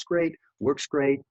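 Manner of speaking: "great, works great" drawn out slowly, each word leaned on; slowed emphasis. Shown as a demonstration. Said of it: "You wanna learn how to say the words".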